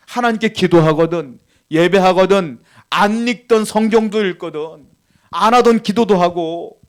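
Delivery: loud, emphatic preaching, each clause driven home; forceful preaching. Real, not acted. Preacher urging believers to pray through hardship, forceful preaching. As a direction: press every point on the congregation with force.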